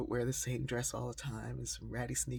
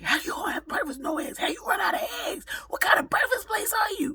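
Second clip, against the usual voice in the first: much higher and much louder, ranting at full force; retold furious shout; 11 dB louder, faster than usual. Hungry man shouting at the server over the eggs, furious shout. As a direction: shout it like a man outraged there are no eggs. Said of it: "he was just yelling at me".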